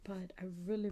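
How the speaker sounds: usual speaking voice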